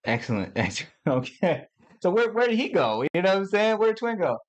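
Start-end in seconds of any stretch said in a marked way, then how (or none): 2.0-4.4 excited high calling. Real, not acted. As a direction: call out for him excitedly.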